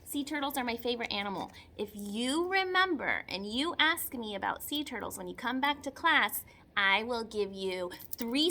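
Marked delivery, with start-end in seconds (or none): none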